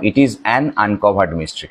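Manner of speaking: slow, with an leaned on; stress on an. Shown as a demonstration. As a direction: spell it out slowly, hitting an. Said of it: "আমরা জানি যে এটা an হবে".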